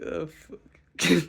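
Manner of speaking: loud swear. Retold or imitated. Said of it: "I was like".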